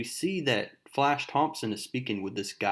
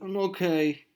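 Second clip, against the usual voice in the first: high, tearful imitation of a girl; mock crying; 7 semitones higher than usual. Crying girl reassuring her friend, mock crying. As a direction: squeak it out as if holding back fake tears. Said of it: "She starts crying".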